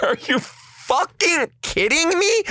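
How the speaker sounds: very high, incredulous squawk; mock outrage. Girlfriend turns and finds no ring, mock outrage.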